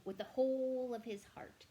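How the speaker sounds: whole stressed high and loud; emphatic. A narrator's reading. Narrator praising the king's love, emphatic stress on whole. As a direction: lean on whole.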